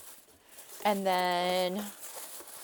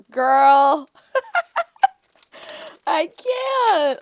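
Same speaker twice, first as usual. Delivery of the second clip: delighted squeal; 8 semitones higher, 12 dB louder, much slower than usual. Real, not acted. Delighted by sticker pack.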